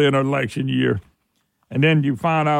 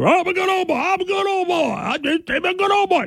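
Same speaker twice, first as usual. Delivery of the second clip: put-on sneering high parody of a candidate bragging he's a good old boy; mock dumb good old boy; 13 semitones higher than usual. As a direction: parrot him like a simpleton, pitched way up. Host mocks candidate's clip.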